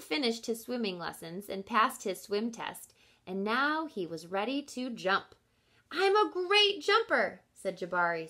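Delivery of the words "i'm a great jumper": put-on bright, higher proud kid voice; boasting child, voice pitched up; boastful child. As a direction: brag like a little boy who is sure of himself. Jabari boasting.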